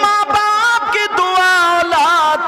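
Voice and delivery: high, loud, slow chanted sermon; full-force preaching. Acted. Preacher proclaiming the Prophet's words on parents' prayers. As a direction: preach the hadith over the crowd.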